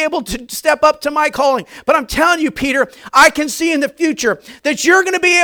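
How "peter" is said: normal talking voice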